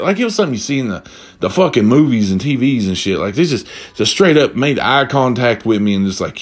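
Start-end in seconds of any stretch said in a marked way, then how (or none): none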